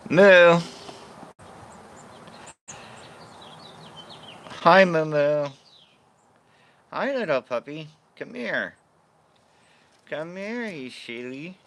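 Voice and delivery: high pet-talk voice; high calling voice for the dog. Real, not acted. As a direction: coo at the puppy. Calling and cooing to her dog.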